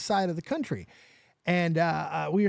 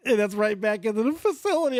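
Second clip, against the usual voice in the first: ordinary talk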